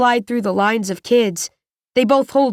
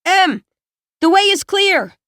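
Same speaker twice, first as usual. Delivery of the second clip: high, raised call through a door; 9 semitones higher, 3 dB louder than usual. A narrator's reading. Bawden calling to Em from the other side of the door, calling out. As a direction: call the all-clear from a distance, pitched well up. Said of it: "I hear Bawden's voice from the other side".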